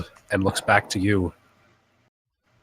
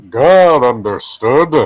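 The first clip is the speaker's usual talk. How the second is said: booming divine voice; voice of God; 5 semitones higher, 10 dB louder, slower than usual. Acted. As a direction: speak as a lofty deity. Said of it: "[name] was the perfect voice of God".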